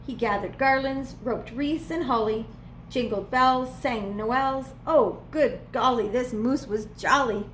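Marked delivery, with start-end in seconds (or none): none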